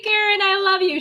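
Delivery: high, through laughs; laughing gush. Real, not acted. Host telling viewers her love for Karen, squealing and laughing. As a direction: squeal it adoringly while laughing.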